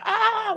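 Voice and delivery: high-pitched mock toddler scream; freaking-out scream. Little cousin fleeing the toy train, freaking-out scream. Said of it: "he was, like, freaking out".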